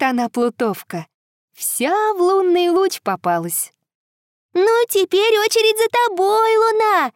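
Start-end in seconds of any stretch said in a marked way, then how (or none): 4.5-7.1 calling out. Acted. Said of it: "кричит девочка".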